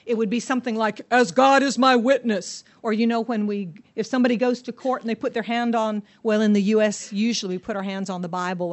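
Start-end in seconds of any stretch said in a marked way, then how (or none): 1.1-2.6 solemn oath. Acted. Acting out Peter's oath.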